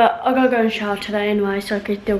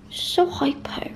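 slow whisper, pitched up; whispered aside; 6 semitones higher, 3 dB quieter, slower than usual. Real, not acted. Aside about the hyper pet, slow whisper. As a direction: breathe it out, exasperated.

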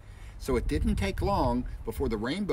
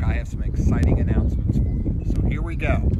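ordinary delivery

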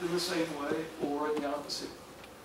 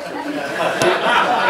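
a big burst of laughter, louder; 13 dB louder than usual. Real, not acted. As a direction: laugh out loud at the joke. Audience enjoys joke about Andy.

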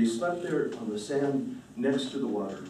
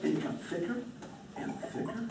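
fading to a whisper; 3 semitones higher, 11 dB quieter than usual. A narrator's reading. Forest closing in.

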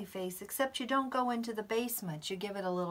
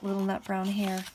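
ordinary talk, nothing marked

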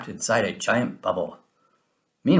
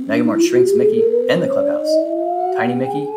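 much higher squeaky voice; tiny voice; 6 dB louder, faster than usual. A narrator's reading. Narrator making the balloon sound tiny, squeaky high. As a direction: shrink your voice to tiny. Shrinking described.

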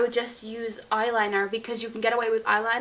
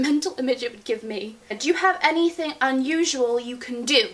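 ordinary talk, nothing marked

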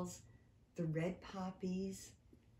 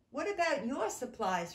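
eager question; 5 semitones higher, 6 dB louder than usual. Acted. Mice asking anxiously.

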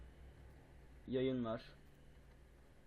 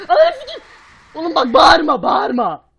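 screaming far higher and louder; panicked scream; 14 semitones higher, 24 dB louder than usual. Real, not acted. Scared by the monster, screaming high and loud. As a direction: shriek in real panic.

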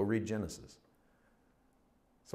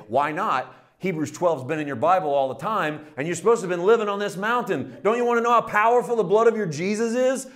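rising louder and higher to a near shout; exasperated outburst; 7 semitones higher, 7 dB louder than usual. Retold or imitated. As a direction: build from a challenge to an exasperated cry. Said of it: "And I wanted to say".

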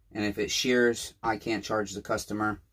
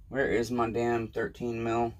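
ordinary talk

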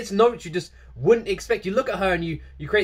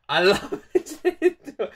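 laughing fondness; 8 semitones higher than usual. Real, not acted. Gushing about singer.

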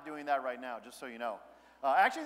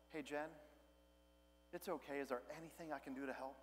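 much softer, tender offer of help; gentle, soft; 2 semitones lower, 14 dB quieter than usual. Retold or imitated. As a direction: soothe your wife, softly. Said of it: "being gentle, being soft".

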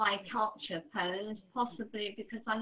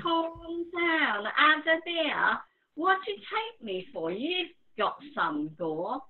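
higher, louder Cockney, slowing on the last line; Cockney bloke telling her to calm down, louder; Cockney voice, repeated; 6 semitones higher, 4 dB louder than usual. Acted. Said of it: "I can do a Cockney voice".

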